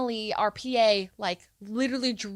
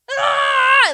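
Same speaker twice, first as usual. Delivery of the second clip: high, loud mock birthing scream; imitated scream; 11 dB louder than usual. Teacher acting out birth to class, loud mock scream. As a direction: belt out a teacher's hammed-up scream. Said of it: "and she's like".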